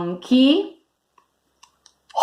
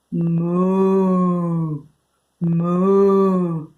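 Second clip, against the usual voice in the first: long, drawn-out moos; cow mooing; 3 semitones lower than usual. Shown as a demonstration. Teacher giving kids a clue for the cow, mooing. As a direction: moo slowly like a cow. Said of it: "The cow says moo".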